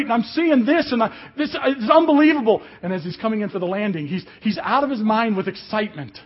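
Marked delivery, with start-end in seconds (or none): 0.1-2.6 mock ecstatic hollering from up in the sky; excited hollering. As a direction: imitate a thrilled friend, high and loud. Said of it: "hollering how awesome and how great".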